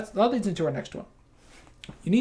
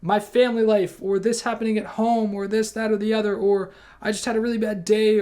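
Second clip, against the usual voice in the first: higher, louder exasperated venting; imitating a venting person; 6 semitones higher, 5 dB louder than usual. Angry person admitting it's their home life, exasperated. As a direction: pour out excuses in a rush of frustration. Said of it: "or they're just like".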